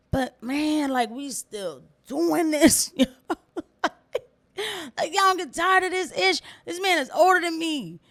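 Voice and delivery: worked up and laughing in disbelief; spazzing out. Real, not acted. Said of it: "I know I'm spazzing out".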